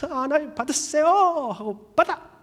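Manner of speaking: high, eager offering voice; eager giver. Retold or imitated. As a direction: push the gift on him gleefully. Giving gift eagerly to God.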